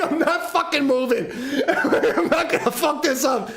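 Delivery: laughing through a retold vow; half-laughing as you quote yourself; giggling while repeating what you told yourself. Quoting his own resolve, laughing.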